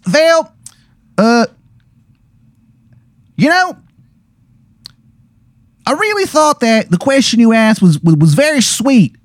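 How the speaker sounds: high-pitched, earnest Baltimore character voice; bad Baltimore accent. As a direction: make a heartfelt speech in broad, high Baltimore. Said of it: "this Baltimore accent".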